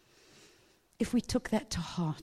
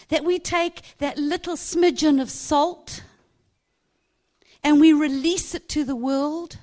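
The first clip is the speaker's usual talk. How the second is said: preaching; 3 semitones higher, 6 dB louder than usual. Acted. Preacher urging the congregation, loud and fervent. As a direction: preach it with rising force.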